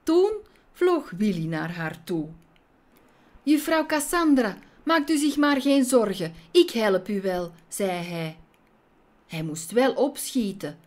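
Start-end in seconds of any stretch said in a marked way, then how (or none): 3.4-7.5 lightly voiced plucky young hero; a light touch of an eager, reassuring boy; brave little fly. Willy reassures trapped lady.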